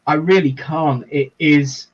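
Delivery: normal talking voice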